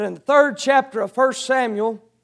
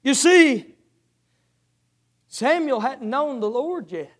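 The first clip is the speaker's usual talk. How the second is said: normal talking voice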